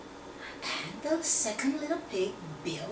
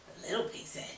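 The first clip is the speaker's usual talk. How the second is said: plain reading voice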